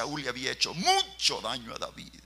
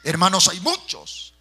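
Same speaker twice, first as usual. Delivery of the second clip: loud, high preacher's cry; preaching shout; 7 semitones higher, 13 dB louder than usual. Acted. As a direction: belt it out to the congregation. Preacher's emphatic climax. (grito de predicador)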